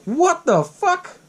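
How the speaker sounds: high, very slow stunned question; mock disbelief. Seeing a cell phone drop from his pocket, drawn-out mock shock. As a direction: stretch each word in high disbelief.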